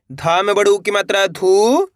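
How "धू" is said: धू held long, swooping up; held syllable. Shown as a demonstration. Teacher sounding out one syllable, धू drawn long. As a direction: stretch धू out on its own. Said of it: "एक अक्षर का उच्चारण सही से".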